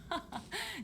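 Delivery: put-on high chuckle of an old lady; warm old laugh. Granny laughing off the travelers' offer, chuckling.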